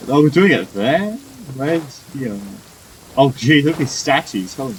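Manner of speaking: ordinary delivery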